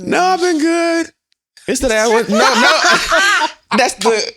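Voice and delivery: high, loud, slow laughing protest. Real, not acted. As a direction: protest through giggles. Laughing while defending herself.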